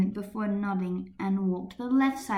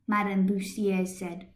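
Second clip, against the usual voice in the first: usual speaking voice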